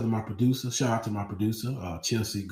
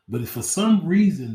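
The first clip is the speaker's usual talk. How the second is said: ordinary talk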